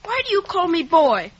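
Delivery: a loud, offended retort; indignant protest. Acted. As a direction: bristle back at her, loud. Boy protests at being called boy.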